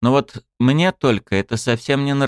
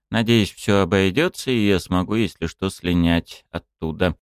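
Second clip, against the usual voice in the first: ordinary delivery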